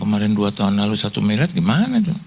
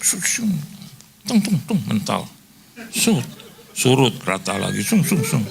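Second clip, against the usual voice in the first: card machine noises; 3 semitones higher than usual. Retold or imitated. Imitating card swipe sounds.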